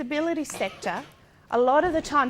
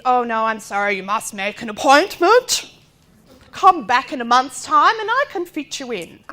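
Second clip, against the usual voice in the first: officious receptionist; 9 dB louder than usual. Retold or imitated. Mimicking receptionist turning her away.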